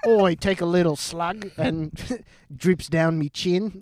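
slow mock-Aussie drinker; Australian accent. Comic describing a slug of vodka, slow put-on Australian accent. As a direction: drawl it like a boozy Australian, nearly cracking up.

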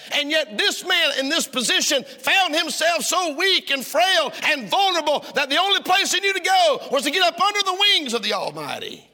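preaching at full force, voice climbing; impassioned preaching. Acted. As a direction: lift the congregation with rising fervor. Preacher rising to a peak.

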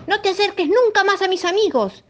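high, loud angry warning; angry hippo. Acted. Hippo warning the crocodile away, angry, high and loud. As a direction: drive the crocodile off for good. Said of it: "dijo el hipopótamo muy enojado".

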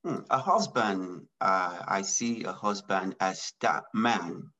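normal talking voice

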